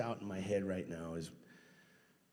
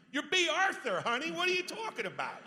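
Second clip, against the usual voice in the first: much higher, louder camp voice; campy disbelief; 5 dB louder than usual. Retold or imitated. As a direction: gush at her like a starstruck fan. Gushing at a star's modesty.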